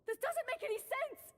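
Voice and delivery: high, shocked protest; stunned disbelief. Acted. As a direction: cry out in disbelief. Stunned denial after cooking result.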